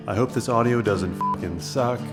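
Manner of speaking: ordinary talk, nothing marked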